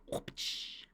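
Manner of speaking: whispered whip crack sound effect; breathy whoosh-and-crack noise, very soft. Real, not acted. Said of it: "I did a whip sound".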